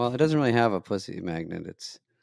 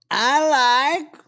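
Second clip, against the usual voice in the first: Borat impression; 8 semitones higher than usual. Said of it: "Borat impression".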